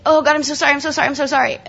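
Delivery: high, gushing run of repeated sorries; mock frantic apology. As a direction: act out panicked apologising to God. Acting out her frantic apologising.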